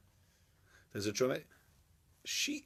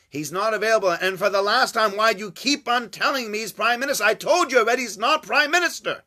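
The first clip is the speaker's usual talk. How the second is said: loud, raised, fed-up retort; exasperated shout; 7 semitones higher, 7 dB louder than usual. Retold or imitated. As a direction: snap back in mock exasperation. Joke: exasperated aide shouting.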